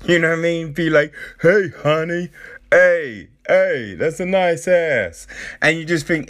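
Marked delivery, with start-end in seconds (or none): 1.4-5.3 higher, louder catcaller imitation; mock catcalling. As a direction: call out like a cheeky catcaller, hammed up. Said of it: "it's like catcalling".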